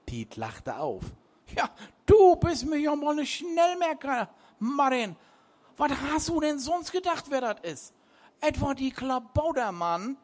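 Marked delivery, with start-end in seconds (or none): 1.5-10.2 put-on much higher, louder old sea dog teasing; old captain mocking. A narrator's reading. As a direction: Piet teasing Martin, high and loud. Piet mocks Martin.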